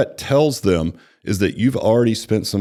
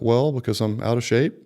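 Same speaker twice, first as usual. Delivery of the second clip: "out of shape" rising as if asking; questioning tone; 3 semitones higher than usual. Acted. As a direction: answer unsurely, lifting "shape" into a question. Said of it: "it sounds like a question".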